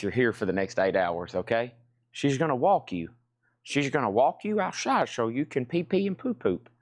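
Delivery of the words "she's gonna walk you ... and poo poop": high, sing-song talk to a dog. Real, not acted. Sweet-talking the dog about a walk.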